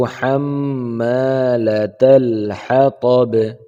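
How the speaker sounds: slow melodic tajweed recitation; chanted Quranic recitation, slow and drawn out. Shown as a demonstration. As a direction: recite slowly with a long melodic line. Quran recitation of Surah Lahab.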